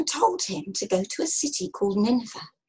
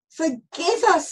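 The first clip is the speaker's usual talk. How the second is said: raised, slow plea; pleading cry; 6 semitones higher, 3 dB louder than usual. A narrator's reading. Sailors begging pardon before tossing Jonah, pleading. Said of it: "the sailors cried".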